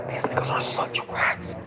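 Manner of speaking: low, slow whisper under the breath; whispered aside. Real, not acted. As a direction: hiss it quietly as an aside.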